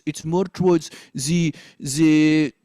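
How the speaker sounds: French accent pushed forward to pouting lips. Shown as a demonstration. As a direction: speak French-accented from the front of your lips. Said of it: "speaking is more towards the front of your lips".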